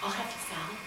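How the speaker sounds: barely audible ordering; tiny soft voice to the waiter; very quiet mom. Retold or imitated. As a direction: imitate a meek mother ordering under her breath. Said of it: "she was just very quiet".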